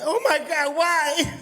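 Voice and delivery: high, loud mock wailing; hammed-up mourner crying out; funeral wail. Mom wailing at a funeral, mock crying. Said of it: "The cry".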